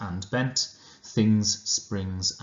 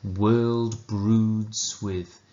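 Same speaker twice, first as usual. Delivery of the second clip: slow, deliberate poetry recital; measured reverent reading of Hopkins; poem reading; 2 semitones higher, slower than usual. Acted. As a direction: savour each line of the sonnet with long pauses. Reads the sonnet aloud.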